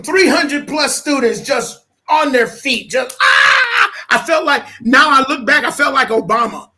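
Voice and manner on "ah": high mock crowd scream; crowd roar. Three hundred students on their feet, high cheering yell. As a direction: imitate an auditorium going wild. Imitating the crowd going wild.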